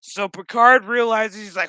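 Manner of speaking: ordinary delivery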